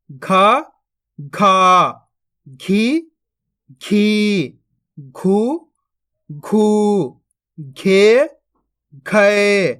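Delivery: syllable drill, short rising then long level-falling vowels; barahkhadi recitation. Shown as a demonstration. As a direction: recite each syllable apart for pupils to repeat, stretching every second one. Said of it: "मैं किस तरीके से इनको पढ़ रहा हूँ". (बारहखड़ी का पाठ, एक-एक अक्षर खींचकर)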